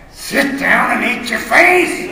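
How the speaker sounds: slow, loud, laughing roar; furious dad. Acted. Father hauling the kid back and roaring at him. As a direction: bellow the order down at them. He's getting mad.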